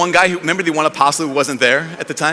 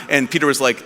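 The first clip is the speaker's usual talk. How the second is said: normal talking voice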